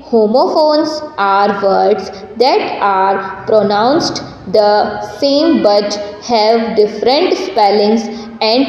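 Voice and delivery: slow, deliberate classroom reading; textbook read-aloud. Acted. Teacher reading the homophones instruction to students, slow read-aloud. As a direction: read it out slowly, word group by word group.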